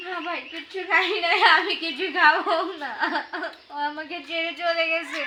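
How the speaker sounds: real sobbing refusal; crying. Real, not acted. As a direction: refuse through tears, abandoned and hurt. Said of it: "কান্না করছেন কেন আপনি". (কাঁদতে কাঁদতে বলা)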